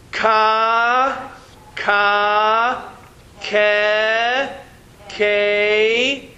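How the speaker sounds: each syllable called out slowly for the class to repeat; syllable drill. Shown as a demonstration. Teacher leads the class in a slow chanted syllable drill. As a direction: lead the class through the drill one sound at a time.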